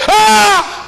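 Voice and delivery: high, loud shocked cry; startled scream. Retold or imitated. Jacob sees Lea instead of Raquel, shrieking high. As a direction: yelp in sudden fright. Husband sees the wrong bride.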